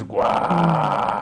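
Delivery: loud, drawn-out "gwah" noise; imitated paper shredding. Retelling the president shredding a letter, imitated ripping noise. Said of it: "I'm shredding this paper".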